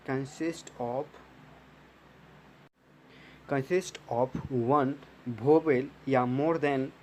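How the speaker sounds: very slow, word by word, like dictating notes; slow dictation. Shown as a demonstration. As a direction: spell the definition out slowly for the class to copy. Dictating a definition to students. (छात्रों को धीरे-धीरे notes लिखवाना)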